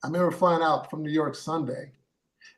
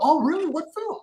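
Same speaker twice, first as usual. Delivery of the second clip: surprised; 12 semitones higher than usual. Retold or imitated. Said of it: "He's like".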